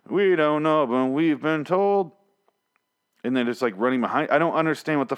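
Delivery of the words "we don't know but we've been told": higher, slow, dopey zombie answer; mock zombie reply. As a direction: let the dumb zombies drone it out slowly.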